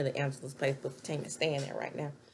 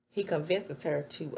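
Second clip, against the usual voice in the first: usual speaking voice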